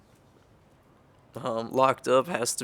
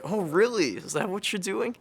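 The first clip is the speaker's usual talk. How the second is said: concerned but curious; 8 semitones higher than usual. Retold or imitated. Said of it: "Both concerned, but also curious".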